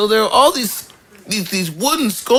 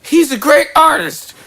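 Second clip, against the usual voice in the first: mother's voice; 10 semitones higher, 4 dB louder than usual. Retold or imitated. Mother quoted in family argument.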